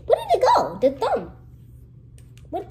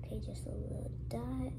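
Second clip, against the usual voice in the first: soft whisper; 13 dB quieter than usual. Real, not acted. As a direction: whisper it carefully. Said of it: "whispering while working".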